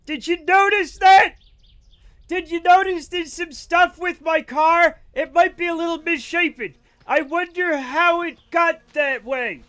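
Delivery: loud, high-pitched sarcastic rant; angry. Real, not acted. Streamer yelling at chat over his wrecked car, angry. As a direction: yell it indignantly, drawing out the accusations. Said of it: "I don't know why I'm so angry".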